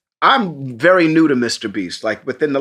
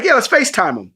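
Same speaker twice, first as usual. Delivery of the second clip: imitating Mister Beast; 9 semitones higher, faster than usual.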